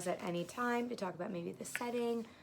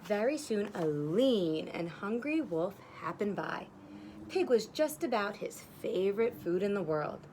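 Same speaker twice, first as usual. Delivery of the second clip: plain reading voice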